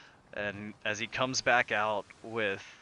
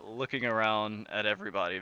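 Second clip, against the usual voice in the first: ordinary delivery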